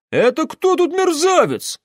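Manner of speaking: very high, loud outraged challenge; indignant. A narrator's reading. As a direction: soldier bristling at an insult. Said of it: "возмутился один из улан". (возмущённый высокий выкрик)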